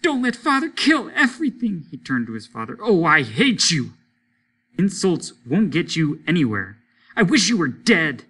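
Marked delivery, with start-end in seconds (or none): 0.0-1.8 high, loud desperate wail; wailing child. Acted. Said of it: "Peter wailed at the ceiling". 2.8-8.2 put-on angry child. Child lashes out at father.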